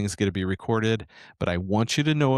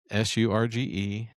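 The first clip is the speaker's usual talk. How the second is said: ordinary talk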